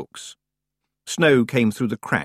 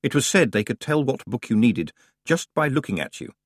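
plain reading voice

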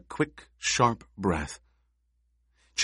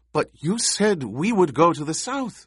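much higher-pitched voice, objecting; protesting; 10 semitones higher, 4 dB louder than usual. A narrator's reading. Chaney objects to Paul's order, protesting. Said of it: "she protested".

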